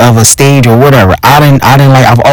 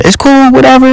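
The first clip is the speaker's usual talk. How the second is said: sarcastic high whatever; 12 semitones higher than usual. Real, not acted. Sarcastic aside about recruitment.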